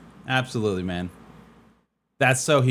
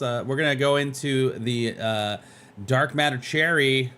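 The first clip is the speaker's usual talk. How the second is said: ordinary delivery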